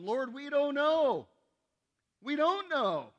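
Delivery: loud, high, slow cry of confusion; Thomas pleading. Acted. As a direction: cry out like a bewildered disciple. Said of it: "Thomas said to him".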